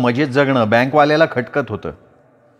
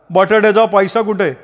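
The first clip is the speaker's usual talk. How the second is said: lightly voiced younger brother, raised pitch; brother's demand; 7 semitones higher than usual. A narrator's reading. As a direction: demand it, half-acted. (रागात विचारणारा भाऊ, हलका आवाज)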